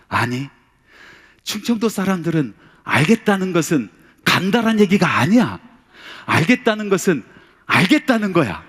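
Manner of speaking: telling the junior pastor off, loud and insistent; emphatic explaining. Retold or imitated. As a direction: drive home each point to the junior. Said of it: "제가 그렇게 이야기를 했습니다".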